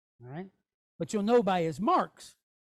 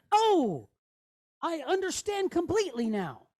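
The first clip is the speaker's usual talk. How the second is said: raised, acted moment of understanding; dawning realisation; 4 semitones higher than usual. Teacher voices the letter figure saying oh, pitched-up realisation. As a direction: exclaim the "oh", then let understanding land. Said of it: "He's saying".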